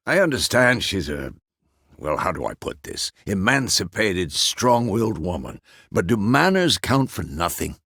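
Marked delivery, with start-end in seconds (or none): none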